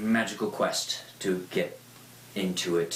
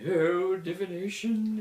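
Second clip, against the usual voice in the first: much higher, dreamy mocking tone; mock mystic; 13 semitones higher, 3 dB louder than usual. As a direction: ham up a spacey seer. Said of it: "like talking to fucking Trelawney".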